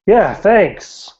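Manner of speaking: high, loud, pointed thanks; sarcastic thanks. Acted. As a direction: thank a rival through jealousy. Jealous man's pointed thanks.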